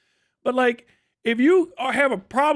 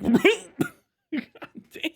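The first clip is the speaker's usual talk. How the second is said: laughing disbelief; 8 semitones higher than usual. Real, not acted. Laughing at the quoted answer.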